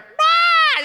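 shrill newborn wail, very high and loud; imitated baby scream. Father imitating his newborn screaming, very high baby wail. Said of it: "He's screaming bloody murder".

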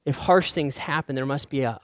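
ordinary talk, nothing marked

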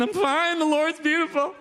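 high, hammed-up weepy voice; imitating himself crying, played for laughs; mock tearful. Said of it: "I'm in my basement crying".

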